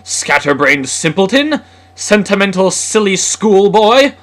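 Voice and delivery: loud, high, storming insults; building to an indignant yell; snarling outburst. A narrator's reading. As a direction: shout it like a furious father. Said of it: "snarled Simon".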